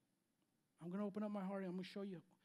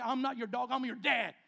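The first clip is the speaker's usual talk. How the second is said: louder, firm father's rebuke; stern retort; 4 semitones higher, 10 dB louder than usual. Retold or imitated. Dad scolding his fourteen-year-old son, firm loud rebuke. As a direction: put the kid in his place. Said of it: "I said".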